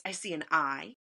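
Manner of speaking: normal talking voice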